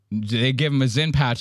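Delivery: usual speaking voice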